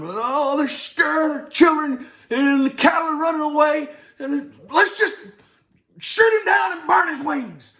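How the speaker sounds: high, loud farmer audition yell; high farmer shout. Acted. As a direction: belt out the line high and loud like a frantic farmer. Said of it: "and I'm, and I'm".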